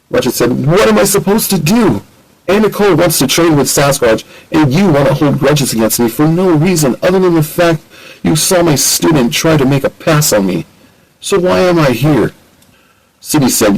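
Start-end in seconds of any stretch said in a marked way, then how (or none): 0.7-12.3 exasperated. Acted. Ratchet argues back with Sydney.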